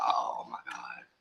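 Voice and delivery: breathless slow whispered awe; awed whisper. Retold or imitated. Grandma moved when the baby recognizes her, whispered. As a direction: breathe it out, overwhelmed. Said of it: "I was like".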